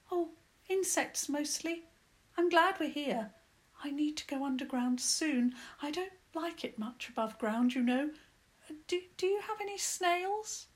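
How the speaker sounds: lightly voiced mole, relieved and weary; little mole voice. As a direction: play the lost mole, grateful and tired. Mole character voice.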